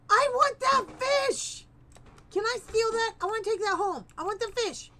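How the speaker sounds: gleeful grabby delight; thrilled gamer spotting loot; excited squeal. Real, not acted. Spots a fish in game.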